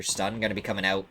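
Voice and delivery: ordinary talk, nothing marked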